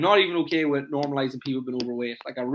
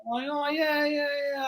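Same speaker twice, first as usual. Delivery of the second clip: much higher, hesitant put-on client voice; evasive client; 12 semitones higher, slower than usual. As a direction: play the client dodging the question, high and unsure. Coach voicing a vague client.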